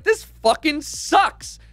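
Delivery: slow, high, drawn-out groan of a complaint; fed up. Retold or imitated. Stuck at the airport with a delayed flight, griping slowly. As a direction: whine it out, stretching every word. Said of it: "And I'm like".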